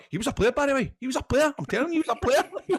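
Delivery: put-on high, loud excited insistence from an old pro; mock old footballer voice. Retelling the footballer's boast in a high, loud, hammed-up voice. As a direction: ham up the veteran swearing he was great. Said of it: "He was going".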